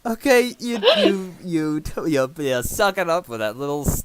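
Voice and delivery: high, loud, exasperated voice. Real, not acted. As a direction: squeal in horrified disbelief. DM reacts to self-injection.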